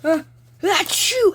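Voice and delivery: rising sneeze into a high loud shout; sneeze build-up. Acted. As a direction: wind up a sneeze and blast the last word. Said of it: "He's like".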